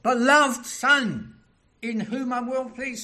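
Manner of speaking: loud, high, ringing declaration; preacher thundering a divine announcement; God the Father's voice. Acted. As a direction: proclaim it as a voice from heaven. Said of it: "It's God the Father's voice".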